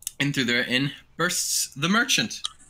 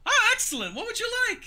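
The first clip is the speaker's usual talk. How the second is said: very high-pitched cartoon shopkeeper; Nico the cat merchant. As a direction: greet customers in a piping cat-merchant voice. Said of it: "He goes".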